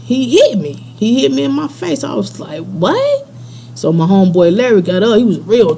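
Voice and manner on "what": very high rising yelp of disbelief; retold shock, voice shooting up; stunned disbelief. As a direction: act out being stunned. Acted reaction to being hit.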